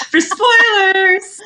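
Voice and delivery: laughing it out, slow and high. Real, not acted. Laughing as she adds the joke about spoilers, real laughter. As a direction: blurt it through real laughter.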